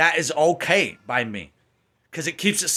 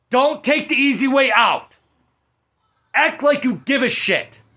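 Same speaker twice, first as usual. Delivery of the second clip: higher, louder furious demand; angry rant; 9 semitones higher, 4 dB louder than usual. Real, not acted. Reviewer scolding the studio, loud angry rant. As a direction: tell the studio off hard.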